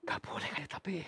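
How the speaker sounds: hushed whispered aside. Real, not acted. Ghost hunter whispering to his crew after being refused.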